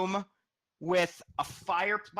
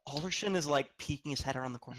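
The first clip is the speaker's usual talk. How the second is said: ordinary talk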